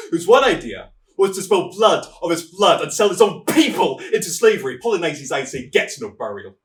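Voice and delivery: furious king's decree. Acted. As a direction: denounce the traitor as your fury rises. King condemns traitor brother.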